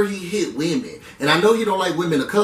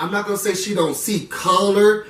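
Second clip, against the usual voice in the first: usual speaking voice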